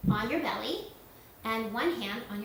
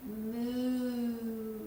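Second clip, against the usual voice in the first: cow moo. Pretend. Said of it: "say moo like the cow".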